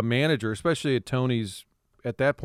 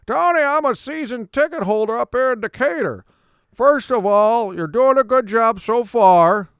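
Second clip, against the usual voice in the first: high, loud eager caller; 9 semitones higher, 5 dB louder than usual. Retold or imitated. A season ticket holder calls Tony, high and eager. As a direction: gush like an eager fan calling in. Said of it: "sort of based Ask Tony off".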